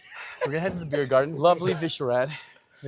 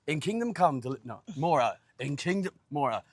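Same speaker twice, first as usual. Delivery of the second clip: put-on feral Cockney accent; 7 dB louder than usual. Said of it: "they kept saying, "More, more".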